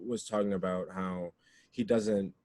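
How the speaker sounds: ordinary delivery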